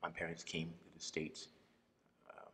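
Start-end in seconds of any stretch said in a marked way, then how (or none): none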